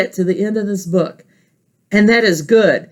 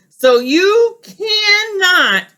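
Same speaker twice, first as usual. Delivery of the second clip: triumphant Grover; 10 semitones higher, 3 dB louder, much slower than usual. Acted. Grover ties pages shut.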